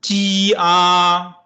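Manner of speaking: G E R spelled slowly; teacher spelling slowly; slow spelled letters. Shown as a demonstration. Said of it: "Repeat after me".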